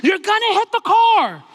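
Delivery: high, loud backseat panic scream; mother screaming. Retold or imitated. Mother warning the driver of a car ahead, screaming. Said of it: "she's screaming bloody murder".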